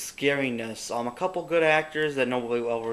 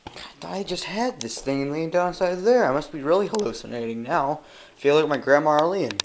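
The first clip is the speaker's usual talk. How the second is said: usual speaking voice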